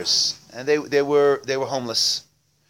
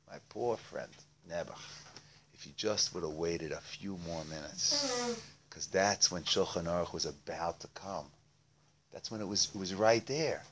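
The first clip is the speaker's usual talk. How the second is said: soft, sympathetic consoling; hushed, rueful explanation to a poor friend; gentle pity; 3 semitones lower, 8 dB quieter than usual. Acted. Friend consoles the fuming beggar.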